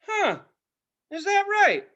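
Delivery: high, sarcastic comeback; mock amazement. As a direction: feign being impressed, voice way up. Acting out sarcastic reply to kid.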